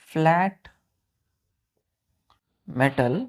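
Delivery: word by word dictation with long pauses; teacher dictating a definition to pupils, slow and word by word. Shown as a demonstration. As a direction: dictate slowly so the class can write each word.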